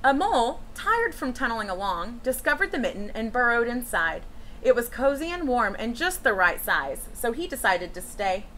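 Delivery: put-on gentle read-aloud for children; storybook reading. A narrator's reading. Teacher reading a picture book to children, storytelling voice. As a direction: read it like story time to a class.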